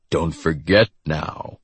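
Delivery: slow, sinister teasing; drawn-out, mildly menacing taunt; sinister tease. A narrator's reading. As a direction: tease it like a veiled threat. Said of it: "he teased, sinister".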